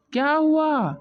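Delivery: sudden high startled question; husband caught off guard in the field. Acted. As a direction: jump in surprise.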